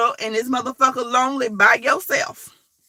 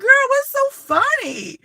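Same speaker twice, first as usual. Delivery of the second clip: mock exasperated question; 9 semitones higher, 4 dB louder than usual. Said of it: "I'm like".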